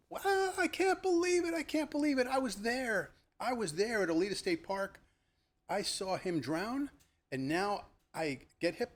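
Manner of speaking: shrill high panicked scream. Retold or imitated. Girl on bike hearing the drowning news, screaming high. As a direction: wail it out in disbelief. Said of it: "She starts screaming".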